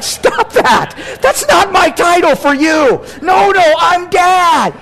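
playfully yelping that he is Dad; mock protest. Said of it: "I'm like".

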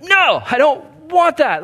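high, loud whiny refusal; stubborn refusal. Pretend. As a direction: play a resisting sinner, high and loud. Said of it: "You're like".

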